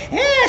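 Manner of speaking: high-pitched mock scream; imitated excited squeal. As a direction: squeal like someone overexcited, hammed-up. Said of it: "getting loud 'cause she was so excited".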